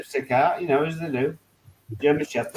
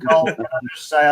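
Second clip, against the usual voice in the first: ordinary delivery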